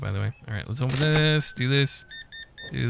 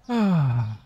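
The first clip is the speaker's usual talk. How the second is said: long sigh; 2 semitones higher than usual. Real, not acted. Pause amid stocking work.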